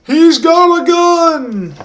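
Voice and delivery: high, slow, drawn-out yell of alarm; mock alarm shout. Teen shouting a fake warning at the fighting men, high and drawn out. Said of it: "I got the balls to go like".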